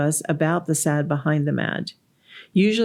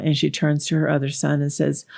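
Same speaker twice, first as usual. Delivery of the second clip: ordinary talk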